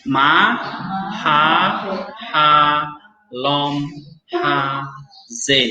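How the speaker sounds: "Ma, ha, lom, ha, ze" split into separate syllables. Shown as a demonstration. Teacher sounding out the Hebrew word syllable by syllable. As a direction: sound out each syllable apart. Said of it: "Baca lagi".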